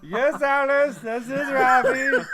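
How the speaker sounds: high, slow mock Indian accent with laughter; imitating Indian uncle. Caller answering his mom as Ravi, mock Indian accent. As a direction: play the uncle's voice on the phone, laughing. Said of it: "I'd go".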